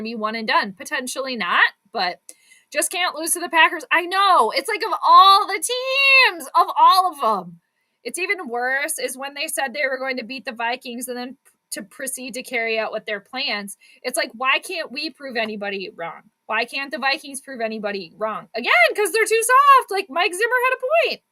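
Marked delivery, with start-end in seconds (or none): 3.9-7.6 anguished fan wail. Real, not acted. Groaning at loss to Packers. 18.5-21.2 exasperated rant. Real, not acted. Ranting about soft team.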